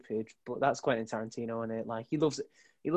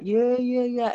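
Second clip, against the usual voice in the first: higher, louder mock excitement; mock cheering; 10 semitones higher, 4 dB louder, much slower than usual. Soldier watching a video with his mates, mock excited cheering. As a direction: cheer along like an eager soldier.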